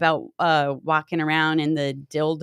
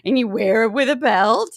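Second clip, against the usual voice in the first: grandma voice; 7 semitones higher, 6 dB louder than usual. Retold or imitated. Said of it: "And Grandma's like".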